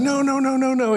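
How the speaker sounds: high, held-up mock protest; alarmed refusal. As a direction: imitate a frantic, high-pitched refusal. Friend's frantic refusal retold.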